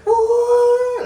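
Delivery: a huge high scream of thrill. Retold or imitated. Flying through the universe, screaming high and loud. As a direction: yell it out like flying on a ride. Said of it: "screaming, flying".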